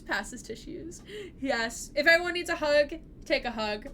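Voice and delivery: ordinary talk, nothing marked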